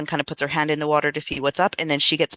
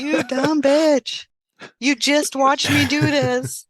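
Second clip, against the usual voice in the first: much higher, slow, mock-scolding squeak; exasperated spaceman voice; 9 semitones higher, much slower than usual. Dying spaceman scolding the girls who ignored the fountain, high slow mock exasperation. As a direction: squeal it out like you can't believe how dumb they are. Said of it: "That's probably what he was trying to say to them".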